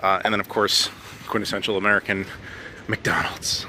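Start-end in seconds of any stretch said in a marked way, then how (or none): 2.9-3.6 whispered aside. Real, not acted. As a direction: drop to a breathy whisper on the name. Whispered aside naming the chain.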